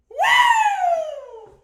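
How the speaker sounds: shrieking high woo; loud high whoop. Real, not acted. Streamer celebrating to chat, shrieked whoop.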